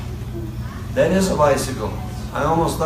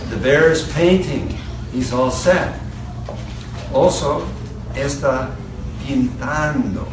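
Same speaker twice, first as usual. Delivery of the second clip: put-on reading to a toddler. Reads picture book to child.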